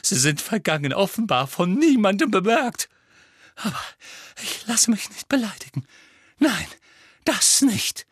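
high, loud, a touch of tearful pride; wounded and defiant, close to tears; mild sob. A narrator's reading. Trelawney tells the class she won't be insulted, high and near tears. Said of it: "schluchzte sie".

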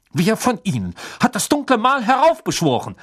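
high, furious interrogation; sharp, enraged questioning; barked accusation. A narrator's reading. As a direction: demand a culprit angrily. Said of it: "bellte er".

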